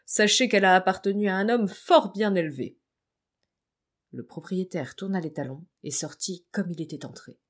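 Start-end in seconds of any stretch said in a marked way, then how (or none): 0.1-2.8 louder, higher solemn rebuke; dignified, earnest protest; grave. Acted. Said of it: "reprit Pierre avec beaucoup de gravité".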